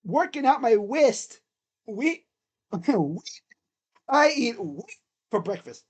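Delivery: goofy cartoon voice with r turned to w; Looney Tunes voice. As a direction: play a cartoon character, drawn-out and silly. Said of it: "what's his name from freaking Looney Tunes".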